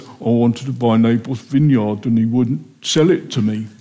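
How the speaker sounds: sulking Ahab. Retold or imitated. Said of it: "He goes".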